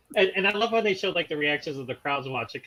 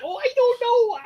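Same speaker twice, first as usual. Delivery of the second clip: put-on squeaky little old lady, pitched way up; high old lady voice; 5 dB louder than usual. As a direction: play a sweet old granny, high. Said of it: "she's like".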